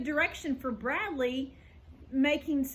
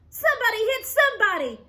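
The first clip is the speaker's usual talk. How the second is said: loud, high, fast eager shout; 8 semitones higher, 7 dB louder, faster than usual. A narrator's reading. Lori shouts to urge the fight on. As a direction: egg them on at the top of your voice. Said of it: "shouted Lori".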